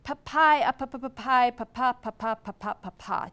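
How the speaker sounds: loud broken pa pa cries fading away; acted cries of agony. The wounded hero crying out, loud then fading. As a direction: burst out then wear down in pain. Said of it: "he releases a series of cries".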